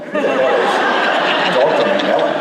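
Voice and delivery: laughing through the question, slowed; incredulous chuckle on every word; laughing disbelief. Real, not acted. Scientist laughing at a misspelled name.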